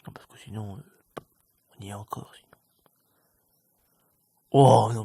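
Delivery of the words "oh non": loud dismayed oh no; loud outburst. Real, not acted. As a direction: burst out loud.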